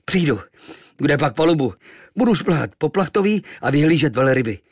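put-on mild boyish defiance; defiant. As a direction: answer back full of fight. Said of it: "řekl bojovně".

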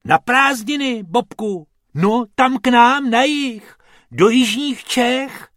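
high, loud, eager answer; holiday longing. A narrator's reading. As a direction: proclaim it with high-pitched enthusiasm. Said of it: "zasnil se Bobek".

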